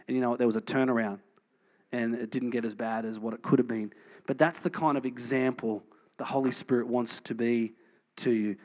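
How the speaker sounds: ordinary delivery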